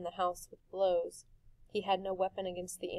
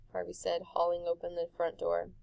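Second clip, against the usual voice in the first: usual speaking voice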